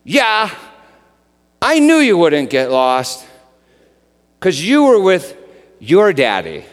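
put-on high, loud, confident child; a three-year-old stating the obvious; sweet, sure little girl voice; little girl's voice. Child answers dad at airport.

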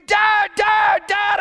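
imitated scream at the top of the lungs; very high, loud yells for Dad. Son inches from dad's face, screaming. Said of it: "he's screaming".

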